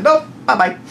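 high sing-out brush-off; mock dismissal. Officials turning the guy away, mock dismissal. Retold refusal to the man.

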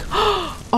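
high, soft gasp; quiet startled intake of breath; soft shocked gasp. Real, not acted. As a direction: catch your breath in shock. Shocked in the game.

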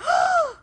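sharp high gasp of surprise; loud astonished gasp; big gasp. Retold or imitated. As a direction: gasp as if starstruck. Madea comes on stage.

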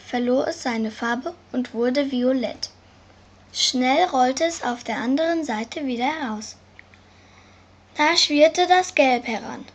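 ordinary delivery

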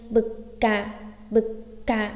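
"bực cả" with a held k between syllables; "bực cả" in two separated beats; doubled consonant. Shown as a demonstration. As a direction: cut bực short, pause, release "cả". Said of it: "sẽ gấp đôi gì".

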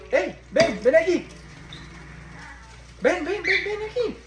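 high-pitched pet calling; coaxing call. Real, not acted. Owner calling the parrot over, high coaxing. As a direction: urge a bird to come in a high voice.

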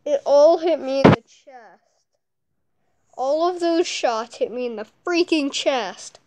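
loud exasperated complaint; loud complaining. Real, not acted. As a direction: gripe loudly about the hits. Complaining about being hit.

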